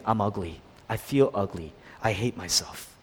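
low, quiet self-loathing murmur; self-hating. Acted. Preacher voices someone hating themselves, low and quiet. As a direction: say it small, dropped and quiet.

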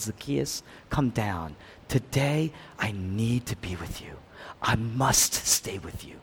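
soft, low and gentle, almost hushed; gentle voice of Jesus calling down from the tree; tender Jesus. Acted. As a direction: call him down kindly, like a friend. Jesus calls Zacchaeus down, gently.

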